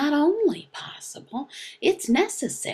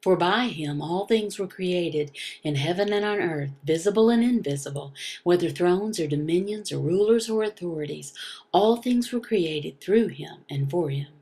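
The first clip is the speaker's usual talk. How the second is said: brisk, fast scripture recitation; quick Bible reading; 5 semitones lower, faster than usual. Acted. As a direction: rattle off the psalm like a familiar text. Reads Colossians aloud.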